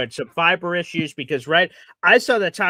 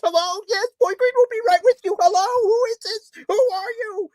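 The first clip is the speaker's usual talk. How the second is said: a much higher, rushed operator voice; imaginary call screener. Pretend. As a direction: play a frantic guy taking calls. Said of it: "the imaginary guy picking up the calls".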